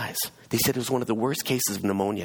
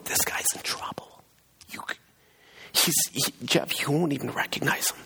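hushed, broken start rising higher; imitating choked up; 7 semitones higher than usual. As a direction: act out struggling to get the words out. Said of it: "like, choked up".

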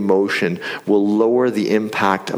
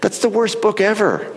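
much higher, hammed-up complaint; mock disgust; 13 semitones higher than usual. Pastor telling Rod he hates the Psalms, exaggerated whine. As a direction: whine it like a sulky kid. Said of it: "And I'm going".

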